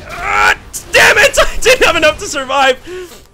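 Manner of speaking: high, loud anguished cry; frustrated shout. Real, not acted. Cries out at failing to survive.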